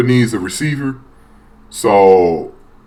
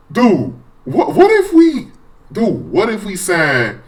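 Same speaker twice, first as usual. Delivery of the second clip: excited; 7 semitones higher, slower than usual. Real, not acted. Excited at the signing idea.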